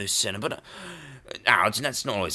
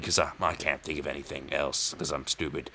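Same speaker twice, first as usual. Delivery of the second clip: low and much softer; muttered self-put-down; 10 semitones lower, 11 dB quieter than usual. Real, not acted. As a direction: mutter under your breath.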